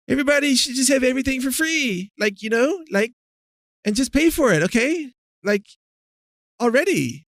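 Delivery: high, mocking ditzy voice, pitched well up; mock Ocasio-Cortez. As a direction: parody her as airheaded and sing-song. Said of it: "This is the way she talks".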